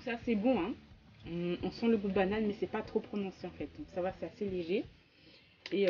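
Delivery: chewing while talking; speaking around a bite of cake; mouth full. Real, not acted. Seen 0: Bit the cake at -7; lips pressed and chewing between words.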